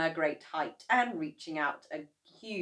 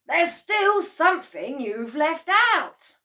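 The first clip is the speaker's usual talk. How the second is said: slow, high, louder father voice; puzzled father; 11 semitones higher, 12 dB louder than usual. A narrator's reading. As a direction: insist something is missing. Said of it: "Mister Cranky said".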